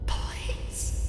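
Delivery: whispered, near tears; tearful whisper; under her breath. A narrator's reading. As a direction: breathe the plea to the wall. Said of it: "she said under her breath".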